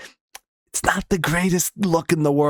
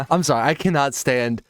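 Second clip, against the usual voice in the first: ordinary talk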